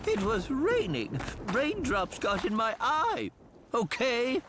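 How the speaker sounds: defensive. Acted. Friend accused of crying blames the rain, defensive.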